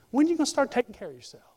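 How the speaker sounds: mock scolding. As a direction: nag like a meddling church lady. Acts a lady scolding Lana.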